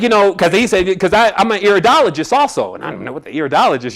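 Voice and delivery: ordinary talk, nothing marked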